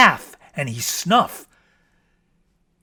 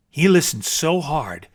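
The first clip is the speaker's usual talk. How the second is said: ordinary delivery, nothing marked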